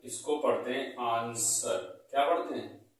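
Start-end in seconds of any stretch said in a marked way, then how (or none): 1.0-1.8 आंसर said slowly with no W sound; आंसर, drawn out, W left silent. Shown as a demonstration. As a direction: say आंसर, going from S straight to the final vowel. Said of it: "इसमें डब्ल्यू silent रहता है".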